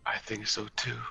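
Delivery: quiet agreeing whisper. Real, not acted. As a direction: whisper back softly. Enemies right above them.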